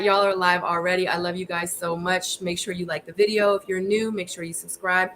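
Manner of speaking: usual speaking voice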